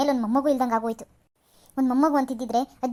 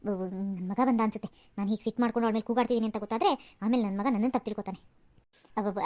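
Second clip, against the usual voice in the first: hushed aside; 5 semitones lower, 6 dB quieter than usual. Acted. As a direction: drop the voice so the son won't hear. Mother-in-law's hushed aside as son arrives. (ಮಗ ಕೇಳದಂತೆ ಮೆಲುದನಿಯಲ್ಲಿ ತನ್ನಷ್ಟಕ್ಕೆ ಹೇಳುವುದು)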